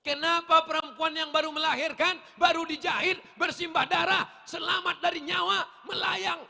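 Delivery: loud, high sustained declamation; forceful rhetorical question to the crowd; impassioned preaching. Real, not acted.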